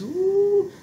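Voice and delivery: high, swooping cry of praise; joyful ooh. Real, not acted. Preacher feeling the peace of God, joyful high ooh. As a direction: let the joy spill over in a rising ooh.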